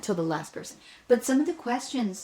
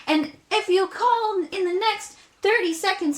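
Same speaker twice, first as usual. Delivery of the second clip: much higher and louder pitchman voice; infomercial announcer; 10 semitones higher, 5 dB louder than usual. Retold or imitated. Mimicking an old cake pan commercial, loud announcer pitch. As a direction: sell it like a TV ad host. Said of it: "then it's like".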